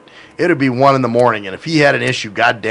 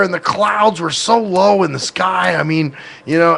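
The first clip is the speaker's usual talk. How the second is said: higher-pitched, enthusiastic retelling; mock a friend raving about a lovely day; gushing female friend; 5 semitones higher than usual. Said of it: "they'll t-, say something like".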